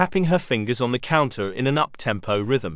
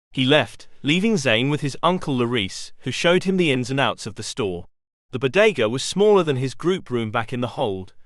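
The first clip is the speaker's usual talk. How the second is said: plain reading voice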